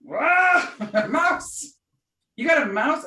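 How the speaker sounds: loud, high yelp of disbelief; mock shock. Retelling dad's reaction to a mouse, loudly shocked. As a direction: act out an alarmed, incredulous reaction.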